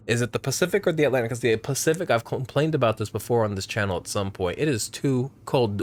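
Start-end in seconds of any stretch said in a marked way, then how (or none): none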